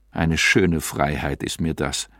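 low, sneering mockery; scornful jeer. Narrator mocks Amalia's idea of freedom, low sneering jeer. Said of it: "höhnte ich".

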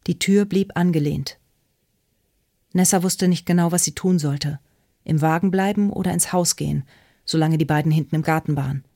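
plain reading voice

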